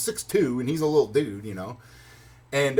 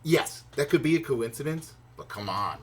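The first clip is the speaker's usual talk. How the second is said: normal talking voice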